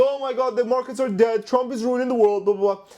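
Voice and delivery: high-pitched mock panic; fearmonger. YouTuber mimics fearmongers clickbaiting about dead markets, mock panic. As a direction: parody a clickbait doomsayer. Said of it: "that's for all the fearmongers".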